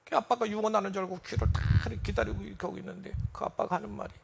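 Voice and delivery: ordinary delivery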